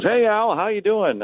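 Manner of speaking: high-pitched imitation of a friendly greeting; chummy visitor. Booth visitor greeting the broadcaster, imitated in a high, chummy voice. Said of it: "And he goes".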